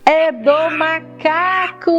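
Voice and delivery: put-on slow, bright toddler voice; reading to a toddler. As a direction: stretch it out for little kids. Animal sound answer.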